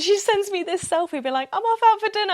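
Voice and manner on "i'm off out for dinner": high, loud, bubbly friend voice; mock-cheery friend bragging about dinner; chirpy friend. Friend's selfie caption voiced.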